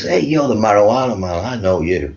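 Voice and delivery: put-on American accent, starting high; imitated American accent. American girl hailing a man she recognises, mock American accent. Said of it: "I have to do this accent".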